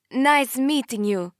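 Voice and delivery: put-on high, slow heavy Italian accent. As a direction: greet him in a thick Italian accent. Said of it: "super heavy Italian accent".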